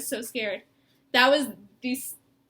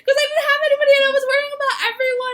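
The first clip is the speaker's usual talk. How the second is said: tearful, pitched up, pushing words out; crying; 11 semitones higher, 7 dB louder, much faster than usual. Real, not acted. Sister crying as she tells of worrying for family. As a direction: voice breaking into high sobs while talking. Tearful recounting of covid fears.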